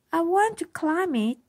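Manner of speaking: put-on high, keen child's voice; eager little girl. A narrator's reading. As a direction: want it badly, like a kid. Eager Iris.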